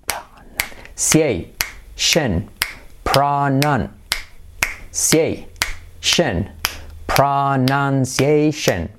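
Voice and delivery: "Pronunciation" very slowly, pra-nan... siei... shen; drawn-out teaching model of "Pronunciation"; slow syllable drill. Shown as a demonstration. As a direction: break "Pronunciation" into stretched chunks. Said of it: "Y ahora, pronon".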